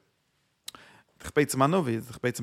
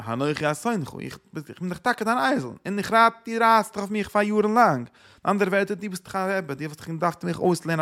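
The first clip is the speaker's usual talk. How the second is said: lightly voiced donkey pleading its case, higher and louder; protesting donkey; 6 semitones higher, 4 dB louder than usual. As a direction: argue back as the talking donkey, pitched up a little. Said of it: "Und kommt der Eisel". (אַן אייזל וואָס טענהט זיך, אַ ביסל העכער)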